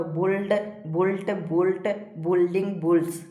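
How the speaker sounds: evenly spaced word-by-word list; steady classroom drill; verb drill recitation. Shown as a demonstration. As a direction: recite each form separately for pupils to memorise. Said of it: "इस प्रकार से सारा को पढ़ते जाना है".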